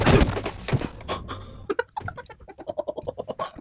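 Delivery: long, high run of laughter; real drawn-out giggling fit; laughing. Real, not acted. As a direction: crack up and keep laughing. Cracks up watching the scene.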